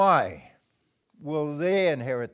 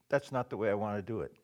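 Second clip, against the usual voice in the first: quiet inner voice; 5 semitones lower, 6 dB quieter, faster than usual. Retold or imitated. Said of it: "the voice inside will say".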